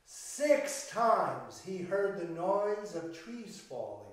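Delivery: ordinary delivery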